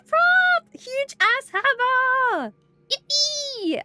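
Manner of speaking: high, loud, drawn-out delight; excited cheer. Real, not acted. Streamer thanking a viewer, cheering with excitement. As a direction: squeal with joy over the hammer.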